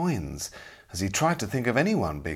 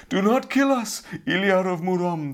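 much higher and louder, terrified begging; pleading cry; 11 semitones higher, 4 dB louder than usual. A narrator's reading. Kneeling robbers beg the knight to spare them, terrified. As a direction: beg for your lives in panic. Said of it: "they cried".

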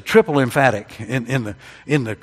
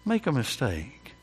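"Make a mistake" said again much softer, letting it sink in; soft repeat; 7 dB quieter than usual. Shown as a demonstration. Preacher echoing his warning to the congregation, softly. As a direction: drop the voice on the echo. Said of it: "repeated softer".

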